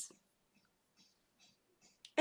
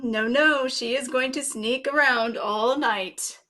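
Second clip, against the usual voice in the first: ordinary delivery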